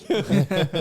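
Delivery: a bright burst of laughter; real laugh. Real, not acted. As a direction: laugh at the blunder. Laughs after oops.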